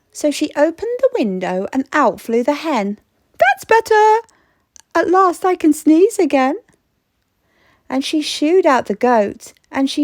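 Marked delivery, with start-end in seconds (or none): 3.4-6.6 put-on high, happy little old lady; old lady relieved. Old lady relieved her house is emptier, high and glad. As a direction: sigh of relief, now there's room.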